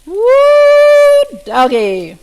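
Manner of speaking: very high, loud whoop of surprise; excited whoop. Real, not acted. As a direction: holler it out as the pan sizzles. Surprise at loud sizzle on flip.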